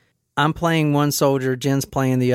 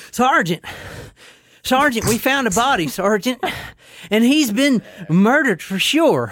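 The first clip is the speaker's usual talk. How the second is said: breathless, badly faked Australian soldier; high-pitched, winded attempt at an Australian accent; 8 semitones higher than usual. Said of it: "gonna try my Australian one".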